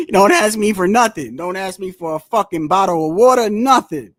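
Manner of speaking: loud, annoyed brush-off; mock angry Amazon exec. Amazon telling PBC off, loud and annoyed. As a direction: bark it like a fed-up company boss.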